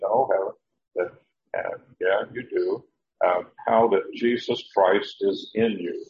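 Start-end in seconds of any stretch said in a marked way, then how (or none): none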